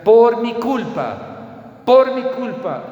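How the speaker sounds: slow, loud and high, beating the breast on Por; liturgical confession. Acted. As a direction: drag out Por mi culpa like a solemn confession. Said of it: "como decimos en el acto penitencial".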